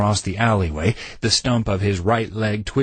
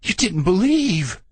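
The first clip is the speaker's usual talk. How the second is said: much higher, slow, incredulous shrill; slow shrill disbelief; 9 semitones higher than usual. A narrator's reading. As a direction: screech his disbelief back at him. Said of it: "Randy shrilled".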